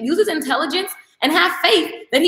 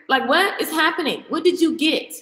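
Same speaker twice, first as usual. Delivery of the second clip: normal talking voice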